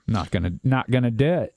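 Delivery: ordinary talk, nothing marked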